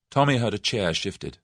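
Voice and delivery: usual speaking voice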